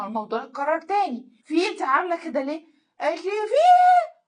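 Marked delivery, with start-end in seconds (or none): 3.3-4.2 very high, loud startled screech; mock shriek. Wife woken by her husband, mock hysterical shriek. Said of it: "وقعدت تصوت".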